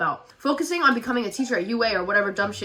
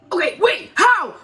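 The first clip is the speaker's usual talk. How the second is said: confused shout; 8 semitones higher, 6 dB louder, slower than usual. Acted. Katsuki confused.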